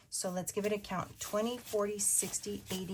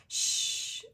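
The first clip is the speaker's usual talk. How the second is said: whispered shush. Retold or imitated. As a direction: hush the baby in church. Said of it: "and I was like".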